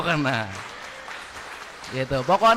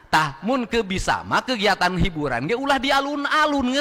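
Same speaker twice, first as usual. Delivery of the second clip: ordinary talk, nothing marked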